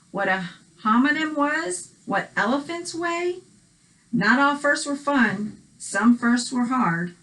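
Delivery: ordinary talk, nothing marked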